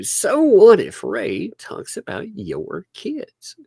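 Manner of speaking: high, drawn-out mimicry of critics; exaggerated mock challenge; mocking imitation. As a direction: mimic opponents slowly, pitched well up. Mimics what opponents keep saying.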